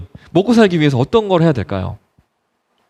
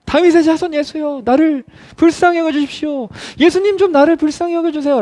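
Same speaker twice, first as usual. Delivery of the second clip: high sustained pleading call; acted desperate plea; raised, insistent crying out; 10 semitones higher than usual. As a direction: keep calling over the crowd, pitched high. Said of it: "더 계속해서 소리를 높입니다".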